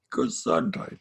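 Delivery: "Gesundheit" said the German way, ga-ZOONT-hite. Shown as a demonstration. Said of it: "we say".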